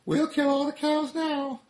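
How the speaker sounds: very high, loud villain cigarette voice; a tiny villain gloating; high gloating cartoon cigarette; Smoking Cigarette. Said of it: "Smoking Cigarette says".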